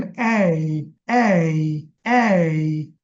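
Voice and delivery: slow, low repeated এই for students to copy; sound drill. Shown as a demonstration. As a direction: drill এই slowly, one by one. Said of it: "আপনি যেন এটা দেখলে এই বুঝে যান". (ধীরে ধীরে এই এই এই বলা)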